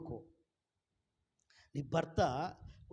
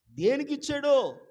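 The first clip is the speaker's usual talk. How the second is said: loud demanding call; 8 semitones higher, 9 dB louder than usual. Real, not acted. Preacher demands an answer from congregation.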